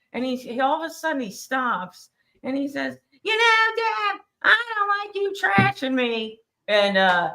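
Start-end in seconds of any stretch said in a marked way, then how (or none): 3.2-6.4 much higher, put-on whiny voice; Rob Saul impression. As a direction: do a high mocking Rob Saul. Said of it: "can you do that Rob Saul impression one more time".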